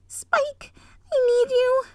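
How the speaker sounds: high, loud call across the house. Acted. Twilight calling Spike downstairs, loud high shout. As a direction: shout for him urgently.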